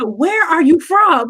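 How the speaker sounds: slow, drawn-out puzzled question; curious surprise. Retold or imitated. Asking an upset colleague where he's from, amazed. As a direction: stop them short with curiosity.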